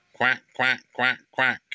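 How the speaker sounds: light quacking chorus; duck family quacking. Acted. Ducks answering the squirrels, light quacks.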